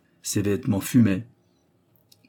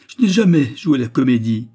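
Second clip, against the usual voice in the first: loud, raised, emphatic denial; insistent protest; 4 semitones higher, 8 dB louder than usual. A narrator's reading. Raymonde denying she ever pretended, loudly insistent. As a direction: stand your ground firmly. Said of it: "répétait-elle en le regardant droit dans les yeux".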